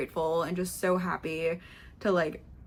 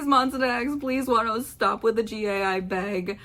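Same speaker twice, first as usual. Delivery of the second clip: usual speaking voice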